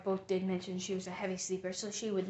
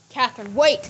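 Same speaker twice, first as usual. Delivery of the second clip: loud, raised call to stop; urgent shout; 4 semitones higher, 18 dB louder than usual. Acted. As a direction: shout after someone to halt them. Calling after a fleeing woman.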